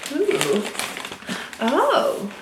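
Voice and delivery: ordinary talk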